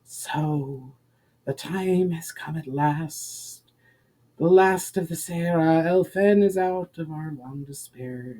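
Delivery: slow, otherworldly voice like the stormy sea; sea spirit's voice. Acted. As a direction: speak like the rushing sea, ancient and sad. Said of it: "voice that sounded like the rushing of the sea".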